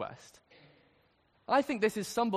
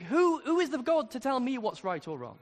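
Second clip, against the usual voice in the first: indignant protest; 5 semitones higher than usual. Retold or imitated. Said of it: "react against and hate and think".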